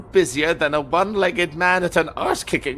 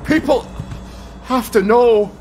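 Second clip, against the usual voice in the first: urgent cry; 9 semitones higher, 4 dB louder than usual. Acted. Urgent outburst.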